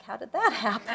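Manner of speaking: joking bewilderment, tipping into a laugh; laughing mock disbelief. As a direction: laugh through the question. Joking about becoming grandmother.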